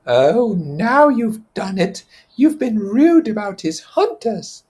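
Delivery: high, slow, whining reproach; Piggy's fretful voice. Acted. Piggy scolding Ralph for insulting Jack's hunters, high and whiny. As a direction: scold him like a worried boy.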